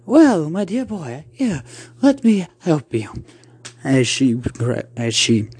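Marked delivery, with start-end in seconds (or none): 0.1-3.1 high, slightly slowed aunt voice; high-voiced aunt soothing a child; kindly aunt. Aunt offering to help her nephew, kindly.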